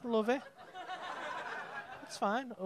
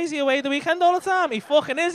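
normal talking voice

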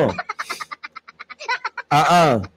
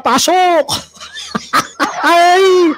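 gleeful shout; 11 semitones higher, much slower than usual. Real, not acted. Gleefully kicking a basher off stream.

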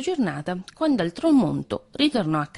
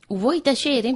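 irritated snap; 7 semitones higher, slower than usual. A narrator's reading. Cat silencing the mouse.

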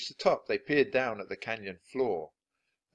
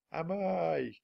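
distant echo; 9 semitones higher than usual. Acted. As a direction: let "Am I?" drift back from far off. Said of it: "came the distant voice".

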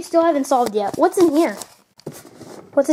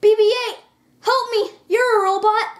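panicked; 8 semitones higher, 3 dB louder than usual. Acted. Shot, calling for help.

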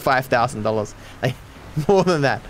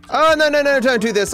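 high, loud burst of dismay easing down; high-pitched cry of oh no; panicked protest; 13 semitones higher, 5 dB louder than usual. Real, not acted. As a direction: plead with the game in alarm. Game mishap, protests live.

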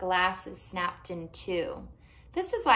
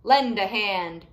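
slow, louder call; shouting; 8 dB louder, slower than usual. Acted. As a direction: call it out across the circle. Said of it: "shouted Miguel".

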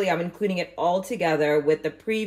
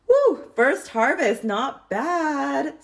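excited cheer; 7 semitones higher, 4 dB louder, much slower than usual. Real, not acted. First harvest weighed, cheering.

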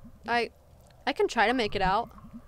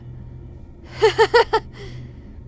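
high, breathy burst of laughter; loud staccato laughing fit; 10 semitones higher, 5 dB louder than usual. Real, not acted. Laughing outburst alone on a line.

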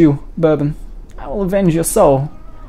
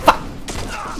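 whispered curse; 8 dB quieter than usual. Real, not acted. Whispered curse mid fight.

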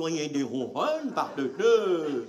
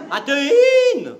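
calling out; 7 semitones higher, 8 dB louder, much slower than usual. Acted. Calling again.

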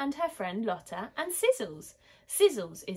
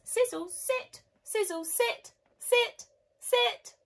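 much higher, insistent dog commands; commanding a dog; 3 dB louder, slower than usual. A narrator's reading. Marv tries to make his dog sit, high and insistent. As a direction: order the dog to sit, more urgently each time.

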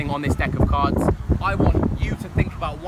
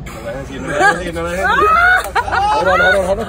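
loud, high frantic protest; loud panicked protest; 10 semitones higher, 11 dB louder, faster than usual. Real, not acted. Spectator panics over trick.

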